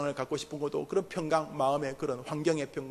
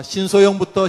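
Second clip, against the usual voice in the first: loud call; 5 semitones higher, 12 dB louder than usual. Real, not acted. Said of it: "같이 합시다".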